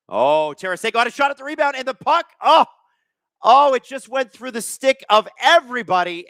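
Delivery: high, urgent play-by-play; real commentator excitement, pitch climbing; excited near-miss call. Real, not acted. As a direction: build to a loud groaning "oh" as the chance slips away. Calling a near goal live.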